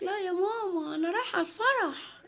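put-on high-pitched child voice; little girl Mona. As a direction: answer mum as a small girl, voice pitched right up. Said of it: "قالت". (صوت بنت صغيرة رفيع)

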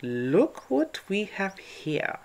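slow, sly sniggering wolf. A narrator's reading. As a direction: gloat over trapped prey. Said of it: "the wolf sniggered".

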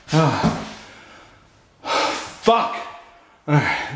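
normal talking voice

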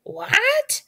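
very high, angry bird-like squawk. A narrator's reading. Betsy hears about Quartz's loss, angry squawk. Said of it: "squawked Betsy angrily".

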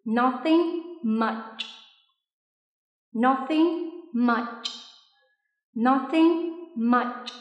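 "Nothing much" drawn out very slowly, ch clear; very slow model. Shown as a demonstration. Teacher modelling the ch ending for students, very slow. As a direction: stretch each syllable, land on ch. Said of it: "Repeat after me".

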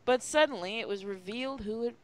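usual speaking voice

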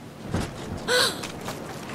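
quick high gasp; startled gasp. Acted. Girl hiding among headstones, startled gasp. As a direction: catch your breath in alarm.

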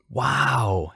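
drawn-out, lifted exclamation of wonder; awed shout. A narrator's reading. Boy sees prayer answered, shouting in awe. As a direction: marvel aloud in amazement. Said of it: "Harry shouted in awe".

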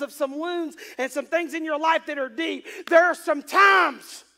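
loud, high, insistent pulpit cadence; full-force preaching. Real, not acted. Preacher tells congregation there's no clarity in storms, full-force preaching. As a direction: hammer each repeated phrase to the congregation.

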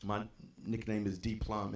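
ordinary talk

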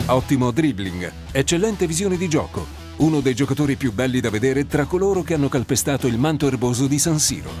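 ordinary talk, nothing marked

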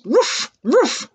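high doggy woofs; dog barks. Shown as a demonstration. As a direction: bark twice like a little dog. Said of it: "they say".

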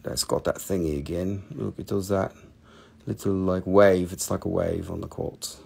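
usual speaking voice

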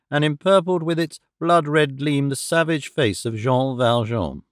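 ordinary delivery, nothing marked

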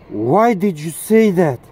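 ordinary talk, nothing marked

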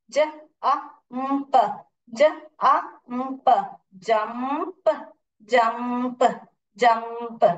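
"ज, अ, म, प" as separate short sounds, then "जंप"; phonics blending. Shown as a demonstration. Teacher blending sounds for pupils, phonics demonstration. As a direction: segment each sound, then join them as "जंप".

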